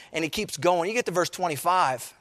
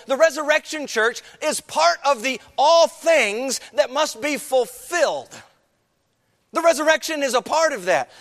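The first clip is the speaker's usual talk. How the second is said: fervent preaching; 3 semitones higher than usual. Acted. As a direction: lift the congregation with a chanting preach. Preaching at full force.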